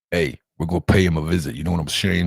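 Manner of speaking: fast low gangster voice; a wise guy leaning in with a threat; mock mobster. Mobster voice, to the woman he threatens.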